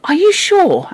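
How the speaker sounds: high-pitched worried question; anxious. A narrator's reading. Anthea asking the Phoenix, anxious. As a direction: ask it anxiously, voice lifted high. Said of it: "anxiously asked the Phoenix".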